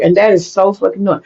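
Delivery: normal talking voice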